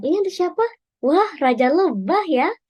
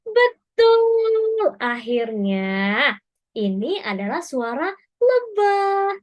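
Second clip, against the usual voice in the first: excited praise; 5 semitones higher, slower than usual. Real, not acted. Cheering children for guessing the bee. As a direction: celebrate the right answer.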